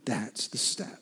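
soft unvoiced whisper; whispered summary of the step of faith. Real, not acted. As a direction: drop to a whisper to land it. Whispered punchline.

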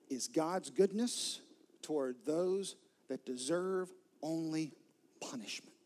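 usual speaking voice

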